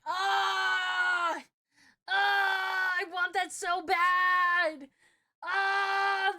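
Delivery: loud, high delighted shrieks; excited screaming. Real, not acted. Streamer screaming with excitement about being cranky. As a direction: scream with joy.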